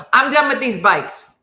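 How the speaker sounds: loud, high, fed-up outburst; start of breakdown. Retold or imitated. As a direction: declare it, pushed past your limit. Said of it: "that's the beginning of the breakdown".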